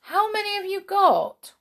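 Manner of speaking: a small excited brownie; huffy little helper elf. Acted. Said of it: "said the brownie".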